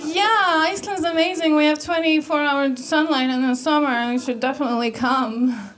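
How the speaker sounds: faked enthusiasm. Icelander faking friendliness to Amber, brightly enthusiastic. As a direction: put on friendliness, easing off at the end. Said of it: "I faked it".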